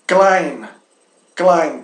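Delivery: "Klein" with ei sounding like "ai". Shown as a demonstration. Teacher showing that ei is pronounced ai, German diphthong demo. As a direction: model "klein" twice, rhyming with English "line". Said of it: "todo o ei se pronuncia ai".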